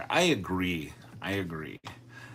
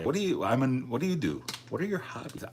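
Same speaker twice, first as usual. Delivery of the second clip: higher, chatty questioner at a party; curious stranger; 5 semitones higher, much faster than usual. Acted. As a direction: play a nosy acquaintance asking about hobbies. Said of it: "with the proper tone of voice".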